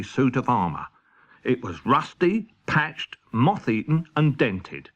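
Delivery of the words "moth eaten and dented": ordinary delivery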